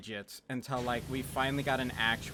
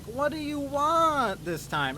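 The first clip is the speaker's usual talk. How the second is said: high, drawn-out wail of exasperation; imitated old woman, much higher and slower; exasperated old lady; 11 semitones higher, 4 dB louder than usual. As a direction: whine it like a fed-up old lady. Exasperated old lady answers again.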